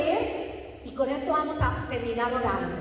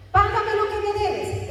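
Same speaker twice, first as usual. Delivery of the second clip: demanding creditor; 7 semitones higher, 4 dB louder than usual. Retold or imitated. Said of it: "Le ahogaba".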